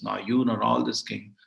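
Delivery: ordinary talk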